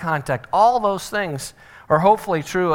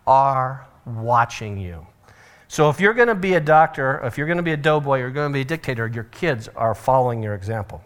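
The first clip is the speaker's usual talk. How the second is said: ordinary talk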